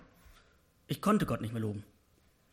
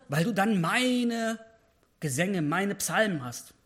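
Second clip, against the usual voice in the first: loud, higher pleading sermon voice, slowing at the end; impassioned preaching; 5 semitones higher, 6 dB louder than usual. Acted. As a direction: preach David's plea at full force. Preaching David's plea.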